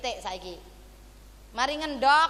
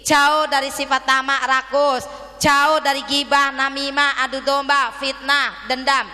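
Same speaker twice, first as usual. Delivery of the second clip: loud, punched-out list; forceful preaching; 3 semitones higher, 10 dB louder than usual. Real, not acted. Preacher warning congregation off vices, forceful and loud. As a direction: hammer each item home at full volume.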